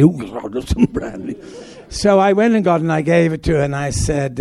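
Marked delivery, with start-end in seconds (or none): 0.0-1.4 put-on voice of a delighted actress; imitating Dame Margaret. Actor mimics Dame Margaret offered brandy, put-on voice. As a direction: mimic her accepting a drink. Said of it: "Would you like some brandy?".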